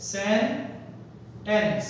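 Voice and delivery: सेंटेंस broken in two, सेन, pause, टेंस; split into syllables. Shown as a demonstration. Teacher asking learners to count syllables, split into syllables. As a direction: say सेंटेंस very slowly with a clear gap between its syllables. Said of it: "how many syllables are there".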